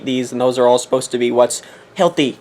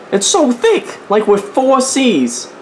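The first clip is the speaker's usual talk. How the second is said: squeaky high mock outrage, slow on four C's; exaggerated complaint; 10 semitones higher, much slower than usual. Complaining to viewers about the jar, in a high mock whine. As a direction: whine it up high, then stretch it out. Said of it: "mock complaint about peanut butter".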